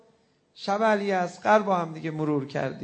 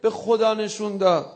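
loud wailing mourning chant, voice breaking; rowzeh lament at full force; 3 semitones higher, 10 dB louder than usual. Acted. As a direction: cry out the lament to God, sobbing.

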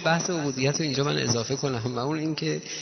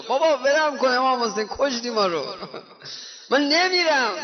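high protest; 8 semitones higher than usual. Retold or imitated. Companion refusing to leave.

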